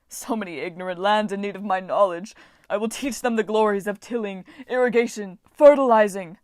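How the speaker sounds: loud, pompous proclamation building to a shout; grand boasting. Acted. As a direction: preach it to the world from your chariot.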